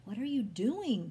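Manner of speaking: loud, dismayed question; alarmed protest. A narrator's reading. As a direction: demand it in protest. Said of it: "Paul protested as he began".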